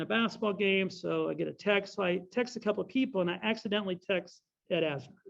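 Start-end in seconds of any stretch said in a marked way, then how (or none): none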